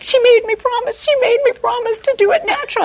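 high defensive protest from the husband; husband's high protest. Retold or imitated. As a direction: squeak out the excuse to the staff.

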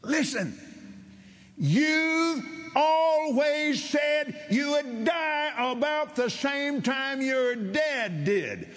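very high, drawn-out proclamation; roaring lion of a preacher. Retold or imitated. Preacher confronts a man over his words, roaring. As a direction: roar it slowly, high and sustained. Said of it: "he was a roaring lion".

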